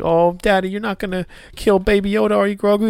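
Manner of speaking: high, put-on little-girl voice; imitated pleading daughters. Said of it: "his daughters were just like".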